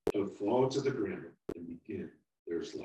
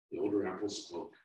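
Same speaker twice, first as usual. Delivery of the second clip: plain reading voice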